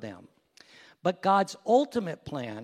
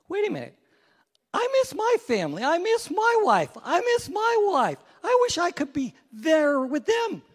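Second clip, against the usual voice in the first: high, loud, hammed-up pleading; frantic second castaway; 12 semitones higher, 7 dB louder than usual. Second castaway wishing himself home to family, frantic. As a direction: blurt it out in a high, urgent voice.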